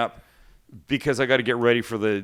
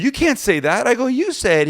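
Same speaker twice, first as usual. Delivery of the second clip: retold argument; 12 semitones higher, 5 dB louder than usual. Heated back and forth retold.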